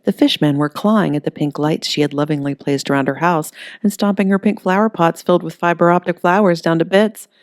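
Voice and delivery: ordinary delivery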